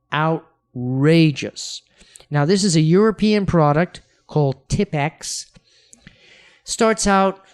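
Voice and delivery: ordinary delivery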